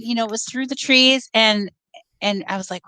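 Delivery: normal talking voice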